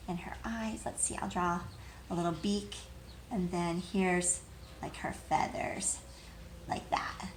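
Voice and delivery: hushed drawing narration, barely voiced; whisper. Real, not acted. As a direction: talk to yourself in a whisper while drawing. Aside while drawing the hen.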